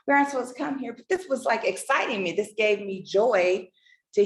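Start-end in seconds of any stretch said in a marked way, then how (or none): 0.1-0.9 lightly voiced young daughter, higher and fast; a hint of a kid complaining, higher; daughters' voice. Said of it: "they were like".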